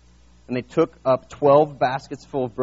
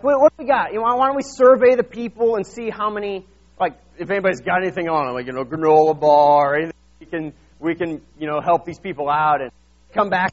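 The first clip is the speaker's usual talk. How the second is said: flustered disciples; 7 dB louder than usual. Retold or imitated. Disciples scrambling to find food, raised and hurried. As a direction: rush it like a panicked planner. Disciples panic over feeding crowd.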